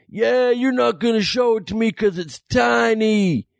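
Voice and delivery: high, drawn-out mock shouting; taunting heckler. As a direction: jeer like an enraged heckler. Acted heckler taunting the comedian.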